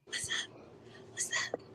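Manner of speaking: whispered greeting; whispering. Real, not acted. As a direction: breathe it without voice. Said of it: "Why are you whispering".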